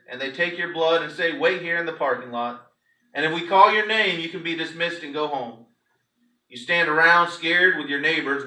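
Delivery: normal talking voice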